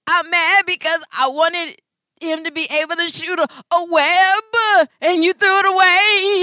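put-on high, loud little boy voice; upset child. Mother voicing her son explaining why he's mad. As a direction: explain it like an aggrieved kid.

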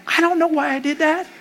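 high, put-on helpless voice; flustered helpless. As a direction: squeak out a clueless excuse. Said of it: "I'm like".

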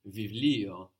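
βιβλίο with both β letters said as V; V sound. Shown as a demonstration. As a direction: say βιβλίο as "vivlio". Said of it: "this gives us a V sound".

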